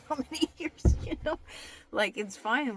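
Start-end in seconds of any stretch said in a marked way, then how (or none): 0.1-1.4 high, loud laugh through the words; laughing, pitched way up. Real, not acted. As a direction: crack up while saying it. Laughing at her own joke.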